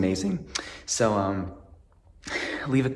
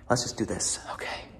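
whisper. Shown as a demonstration. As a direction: breathe it almost silently. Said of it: "best A S M R artist".

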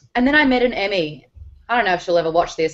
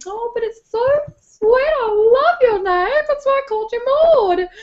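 impersonating her mum; 14 semitones higher, 4 dB louder than usual. Retold or imitated. Imitating her doting mum refusing the name change. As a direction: coo it like a fond mum. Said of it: "a really spot-on impersonation of my mum".

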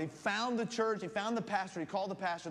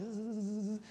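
drawn-out, higher-starting zzz; buzzing; 3 semitones higher than usual. Retold or imitated. Storyteller imitating buzzing while walking closer, sustained buzz. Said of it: "I hear all this buzzing".

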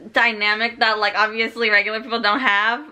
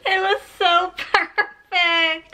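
high, slow happy whine; choked-up adoring wail; moved, tearful gushing; 6 semitones higher, slower than usual. Real, not acted. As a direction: draw it out as if about to cry. Moved by the book's ending.

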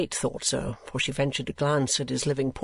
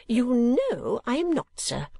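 high, slow, emphatic denial; affronted matron, dropping on the last word; offended lady; 10 semitones higher, slower than usual. Acted. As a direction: deny it in a high, ladylike voice. Offended lady answers the stranger.

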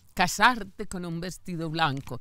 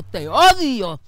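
loud, sharp cry of hate; a son screaming te odio; retold son's yell; 3 semitones higher, 9 dB louder, slower than usual. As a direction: yell it at your mother. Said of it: "porque le gritó".

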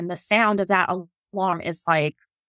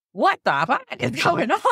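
retold yell; 6 semitones higher than usual.

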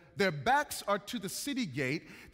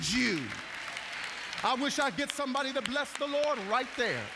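raised, ringing sermon climax; impassioned preaching; 5 semitones higher, 3 dB louder than usual. Real, not acted. Preacher telling the congregation they are valuable, impassioned preaching. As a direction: preach it at full force, higher pitched.

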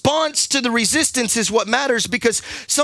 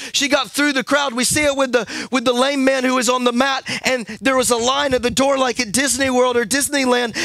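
ordinary talk

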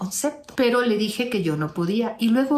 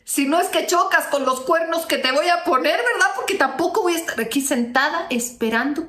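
mocking taunt; 6 semitones higher than usual. Mocking threat to the ex.

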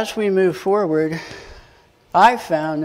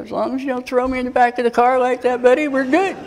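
laughing joke; 7 semitones higher, faster than usual. Retold or imitated. Retold joking reply to cop.

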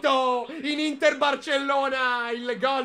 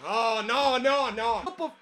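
panicked denial; 2 semitones higher than usual. Real, not acted. Denial at danger play.

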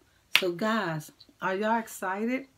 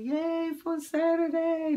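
excited cheer; 8 semitones higher, 4 dB louder than usual. Acted. Cheering the weekend for kids.

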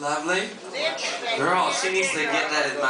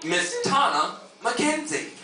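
high, slow announcer call; 10 semitones higher, slower than usual. Real, not acted. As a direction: call her name to the stage. Host calling contestant to stage.

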